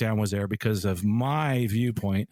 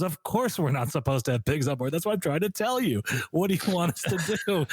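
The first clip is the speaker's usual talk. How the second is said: mildly exasperated flight attendant, pitch climbing higher; exasperated retort; 6 semitones higher than usual. Retold or imitated. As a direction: imitate her fed-up explaining, rising in pitch. Retold exasperated flight attendant answering pilot.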